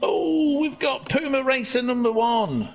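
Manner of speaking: high, delighted racing call; excited commentary. Real, not acted. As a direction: open on a high "oh" and ease down. Excited spotting of a racer.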